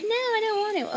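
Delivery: high-pitched girlish protest, imitating her; mock protest. Voicing the girl's refusal.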